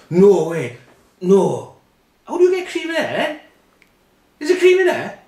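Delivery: very high, loud disbelief; squealing wide-eyed wonder; mock astonishment. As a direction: shriek it like a child finding treasure. [name] amazed at cream inside.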